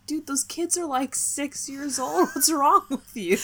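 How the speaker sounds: high, laughing disbelief at the bullies; incredulous scolding. Retold or imitated. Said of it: "and I'm just like".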